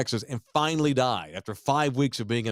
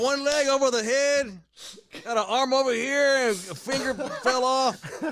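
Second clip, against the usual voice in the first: laughing; 13 semitones higher than usual. Real, not acted. Grisly story told laughing.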